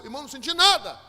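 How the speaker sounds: loud, high insistence; emphatic outburst. Real, not acted. Preacher insists to church he felt nothing, emphatic. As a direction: burst out with the denial.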